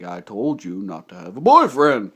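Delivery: a hint of an angry dad telling off; scolding father. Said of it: "you're like".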